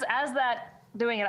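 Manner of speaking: ordinary talk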